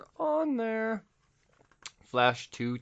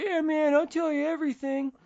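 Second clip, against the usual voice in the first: put-on much higher, squeaky kid voice; eager kid. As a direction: play the eager snot-nosed kid. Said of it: "he's like".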